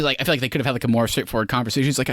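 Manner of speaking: normal talking voice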